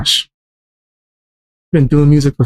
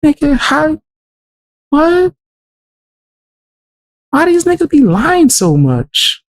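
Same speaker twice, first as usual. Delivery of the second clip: can't believe what he's hearing, pitch way up; incredulous outrage; 8 semitones higher than usual. Real, not acted. Reacting to the rapper's lyrics, higher incredulous outrage. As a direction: voice jumps much higher in disbelief.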